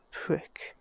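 normal talking voice

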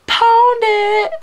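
very high, loud catchphrase cry; playful shout. Real, not acted. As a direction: holler it out in a high squeal.

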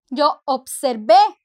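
Yo observé with the last syllable é stressed and rising; stress on the final é. Shown as a demonstration. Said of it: "con acento".

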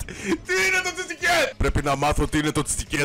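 high playful demand; high comic demand. Real, not acted. Demanding meaning of word.

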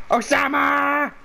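long drawn-out shout; calling out. Real, not acted. Calling out to a player.

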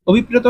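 অভিপ্রেত said clearly in standard Bangla; standard pronunciation. Shown as a demonstration. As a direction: pronounce অভিপ্রেত the standard way, each syllable clear. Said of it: "প্রমিত উচ্চারণ হচ্ছে". (প্রমিত উচ্চারণে অভিপ্রেত)

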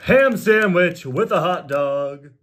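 slow, loud, drawn-out declaration; hammed-up storybook finale; dramatic proclamation. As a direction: proclaim it grandly, stretching each word. Book's absurd final line acted.

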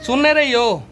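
loud call across the room. Real, not acted. Calling out to crying baby.

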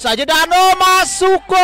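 high, loud rising header call; goal call. Real, not acted. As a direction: build into a shouted goal. Header goal, commentator erupts.

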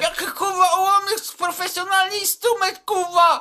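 high, loud mocking yell with a laugh. Kid yelling at the class, high and loud. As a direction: yell it out, cracking up. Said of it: "On się darł".

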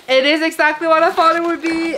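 excited. Real, not acted. Excited unboxing of book.

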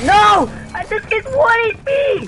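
very high, loud scream of protest; dismayed yell. Real, not acted. Gamer left on one HP, dismayed high yell. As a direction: scream it in real frustration, high and drawn out. Dismayed outburst during game fight.

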